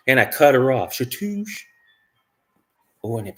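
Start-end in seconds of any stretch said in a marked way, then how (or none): 0.9-1.6 cutting swoosh noise. Retold or imitated. Sound effect for cutting her off.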